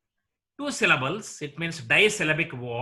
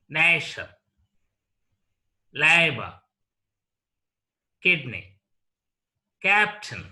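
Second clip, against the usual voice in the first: "doctor", "neighbor", "actor" and the rest, each first syllable punched, second falling; word list from "doctor" to "captain", separated by pauses, stress up front. Shown as a demonstration. Teacher giving examples like "doctor", each stressed on the first syllable.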